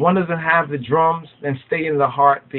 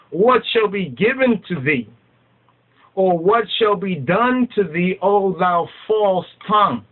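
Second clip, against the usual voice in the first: proclaimed scripture; 6 semitones higher than usual. Acted. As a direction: call the verse out to the false tongues. Reads verse three aloud.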